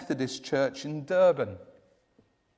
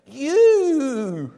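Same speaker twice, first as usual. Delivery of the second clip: put-on high, louder, wondering old woman; amazed old lady; 9 semitones higher, 9 dB louder than usual. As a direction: marvel at him like an old lady. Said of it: "said one old lady".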